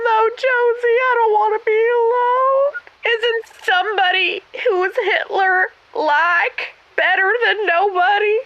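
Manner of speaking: hammed-up weepy whine; mock crying. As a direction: plead through mock tears. Caller pleading, crying over boyfriend.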